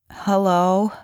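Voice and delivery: freshly woken, groggy greeting; sleepy mumble. A narrator's reading. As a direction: answer the phone half awake. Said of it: "she mumbled, her voice raspy from sleep".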